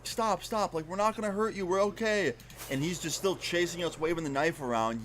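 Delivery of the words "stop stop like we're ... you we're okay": high, pleading mock yell without much volume; retold yelling back. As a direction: plead with an attacker, pitched up. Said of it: "we're trying to yell back".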